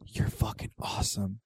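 breathy unvoiced whisper. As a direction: whisper it to the kid. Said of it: "I went over them like".

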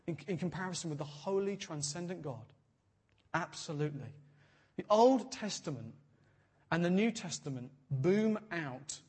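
ordinary delivery

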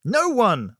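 high, loud desperate scream; full-voiced, high-pitched outburst; screamed reply. A narrator's reading. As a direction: scream it back at the interrogator. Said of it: "I screamed back".

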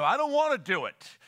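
acted-out protest to God; balking protest. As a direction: push back like a reluctant child. Acts balking at God's command.

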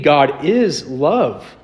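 very slow, weighty declaration; slow emphatic. Acted. Pastor declaring the verse, slow and weighty. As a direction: lay down each word slowly. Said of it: "climactic scripture line".

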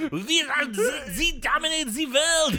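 mock German accent. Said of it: "your attempt at German accent with a Z".

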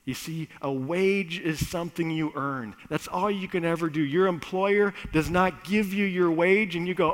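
usual speaking voice

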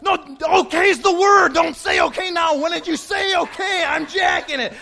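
high, frantic yelled correction; exasperated shouting. Retold or imitated. Driver correcting confused passenger mid-crisis, high exasperated yelling.

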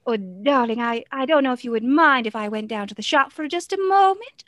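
flouncy, sing-songy character voice; breathy mid-Atlantic darling, lilting through extra words; Lucy. Shown as a demonstration. Performer showing how the character asks to visit the shop, flouncy and sing-songy. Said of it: "Whereas Lucy would say something like".

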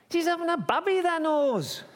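high, urgent yell; exasperated shout. Acted. Joseph telling the innkeeper about Mary, shouting urgently. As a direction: yell it high as the winning argument.

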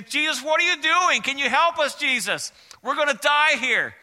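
frightened disciples shouting over the storm; panicked disciples. Acted. Disciples in the storm crying to Jesus, high and loud with alarm.

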